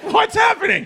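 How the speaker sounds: high, laughing disbelief; baffled. Retold or imitated. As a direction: squeak it out in total confusion. Teller's baffled reaction.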